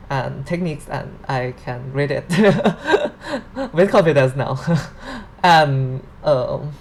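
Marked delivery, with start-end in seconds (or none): 2.3-4.9 laughing. Real, not acted. Laughs at own claim.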